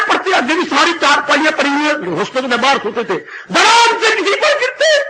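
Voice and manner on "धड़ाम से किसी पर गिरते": high, louder laugh-cry; telling of a sleepwalking student falling on cots, laughing and shouting high. Real, not acted. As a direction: crack up while telling the crash. Sleepwalker crashing onto cots.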